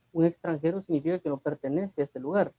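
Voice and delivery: usual speaking voice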